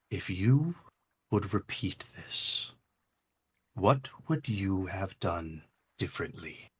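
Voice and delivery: barely above a whisper, eerie; a mysterious heart questioning softly; hushed heart voice. Acted. The heart asks.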